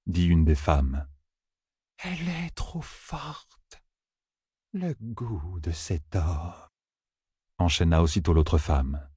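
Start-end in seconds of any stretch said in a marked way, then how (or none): 2.0-6.7 hushed, slow, entranced delivery; strained oracle woman. A narrator's reading. Women on pedestals sensing the tension, hushed slow oracle. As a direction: draw each word out very softly.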